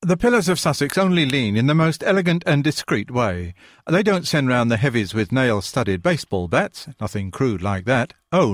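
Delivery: lightly hammed-up posh English accent; a hint of a posh English accent, mocking. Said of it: "adopted a mock posh accent".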